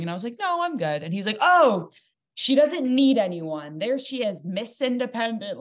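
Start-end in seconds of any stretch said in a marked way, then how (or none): 0.4-1.0 high-pitched polite brush-off; imitated sing-out refusal; breezy refusal. Retells turning down his offer. 1.4-5.6 high, drawn-out sarcastic taunt; loud "oh", then slow high sneering; mocking jeer. Acts out his mocking jab.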